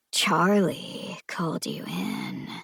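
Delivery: slow, soft, unvoiced whisper; inner voice whisper. Voice in Eve's head warning her, whispered. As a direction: hiss it inside her head. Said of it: "that voice in Eve's head".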